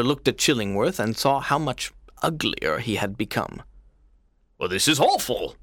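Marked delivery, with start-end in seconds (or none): 4.6-5.6 appalled cry. A narrator's reading. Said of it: "cried the governor".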